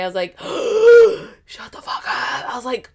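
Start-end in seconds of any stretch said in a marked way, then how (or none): 0.4-1.3 big, loud, high gasp; shocked gasp. Retold or imitated. Her hearing who tried to visit, hammed-up shocked gasp. Reacting to his news. 1.4-2.5 shocked whisper. Retold or imitated. Her reacting to who tried to visit, stunned whisper. Shocked reply to his news.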